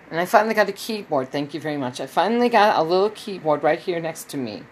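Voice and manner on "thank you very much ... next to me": ordinary talk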